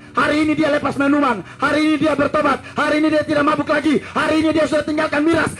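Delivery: high, ringing preacher's cry building up; fiery preaching. Acted. Preacher calling sinners to Jesus, fiery high preaching. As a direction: call every sinner in.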